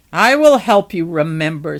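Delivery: loud, forceful declaration; threatening promise. A narrator's reading. Noah threatens the head.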